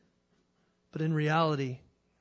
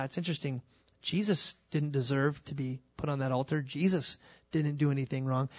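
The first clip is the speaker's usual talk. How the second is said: ordinary talk, nothing marked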